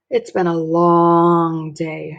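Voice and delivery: slow, tired sigh of a line; weary mom. Acted. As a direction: drag it out like an exhausted mother.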